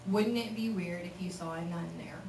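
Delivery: ordinary talk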